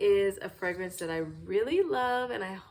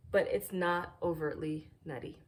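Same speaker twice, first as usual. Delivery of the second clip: normal talking voice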